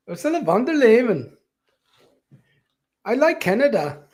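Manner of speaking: high, put-on German accent; mock a German official in a German accent; hammed-up German accent. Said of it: "talk like a German".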